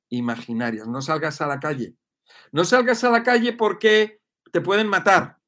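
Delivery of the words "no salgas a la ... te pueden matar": higher, louder mock alarm; alarmist warning. Mimicking media scaring viewers, loud alarmist warning. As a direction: stress salgas like a scary TV warning. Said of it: "Los medios de comunicación te están machacando".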